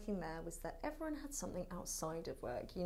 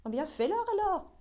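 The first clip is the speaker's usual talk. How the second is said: high, loud exasperated burst in French; heated outburst; 12 semitones higher, 11 dB louder than usual. Acted. French monologue challenging those who judge, heated outburst. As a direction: throw it out loudly, pitch leaping up.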